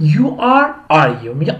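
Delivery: ordinary talk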